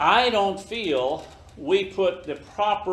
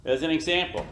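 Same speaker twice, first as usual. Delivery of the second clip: normal talking voice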